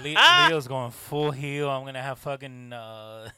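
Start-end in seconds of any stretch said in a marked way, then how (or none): 0.0-1.7 hyped announcement. Pretend. Hyping his heel turn.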